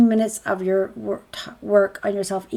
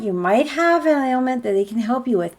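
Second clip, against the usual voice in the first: usual speaking voice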